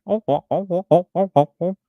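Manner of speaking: high, clucking mock hen cackle; hen laying an egg. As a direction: cluck in short bursts like a broody hen. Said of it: "sound like a hen who's trying to lay an egg".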